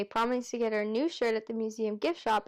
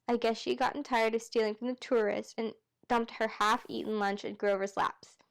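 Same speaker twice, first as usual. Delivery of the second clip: usual speaking voice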